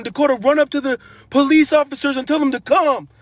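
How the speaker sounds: father urgently calls son to get the police, loud; urgent retold yell. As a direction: shout the order to the boy across the beach.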